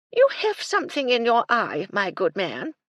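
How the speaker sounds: high, loud lofty voice; haughty old lady. Acted. Lady Belville remarking on the Colonel's eye, lofty and high. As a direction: look down your nose at him.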